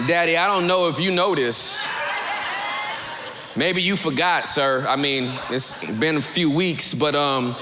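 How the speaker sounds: low-voiced, hesitant son; [name] addressing his daddy. [name] reminding his father he was forgotten, low and hesitant. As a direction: bring up a delicate matter with Daddy, low and halting.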